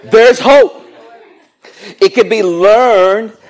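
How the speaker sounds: high, loud proclamation of good news; exclaiming. Real, not acted. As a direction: call it out to the whole crowd. Calls out hope to the crowd.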